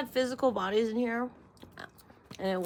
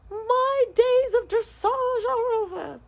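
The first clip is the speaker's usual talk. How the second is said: mock dramatic lament; 7 dB louder, slower than usual.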